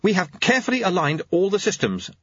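high-pitched earnest clockmaker; high, keen clockmaker voice; Jeremy. Acted. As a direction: pitch it up for an eager young inventor.

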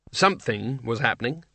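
usual speaking voice

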